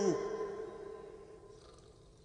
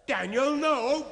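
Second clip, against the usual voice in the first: desperate shout; 7 semitones higher, 7 dB louder, slower than usual. Acted. Priest cries out to stop him.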